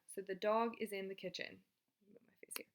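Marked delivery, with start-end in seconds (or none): 2.1-2.7 under-the-breath whisper to herself; muttered whispered stage aside. Real, not acted. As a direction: whisper it off-mic. Teacher adjusting her camera.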